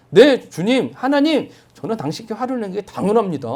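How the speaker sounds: high, loud indignant reply; angry retort. Retold or imitated. Jonah answering God angrily, indignant high retort. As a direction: snap back at God in anger. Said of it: "하나님께 화를 내면서 하나님께 하는 말".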